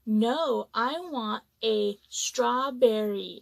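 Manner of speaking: slow, emphatic demand, word by word; insistent customer. Retold or imitated. Customer correcting the server about his strawberry, slow and insistent. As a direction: spell it out slowly and firmly, imitating a customer.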